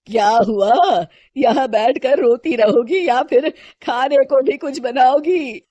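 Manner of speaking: mother-in-law jeering at her; lightly voiced sarcastic elder; taunting mother-in-law. Mother-in-law taunts crying Shilpa.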